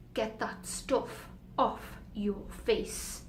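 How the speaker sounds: very slow, measured order; stern teacher. Acted. Teacher orders pupil to remove makeup, slow and stern. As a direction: space the words right out.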